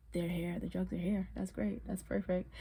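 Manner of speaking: very soft deadpan; resigned sarcasm. Retold or imitated. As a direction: say it under the voice, sarcastically defeated. Sarcastic retold reaction to find.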